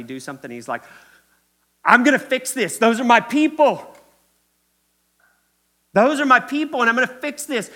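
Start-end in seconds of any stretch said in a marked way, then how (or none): 1.8-7.7 hot-headed shout, high and loud; young Moses. Acted. Younger Moses rushing to rescue his people, impetuous shouting. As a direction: shout it headlong, full of rash resolve. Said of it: "Remember when he was younger?".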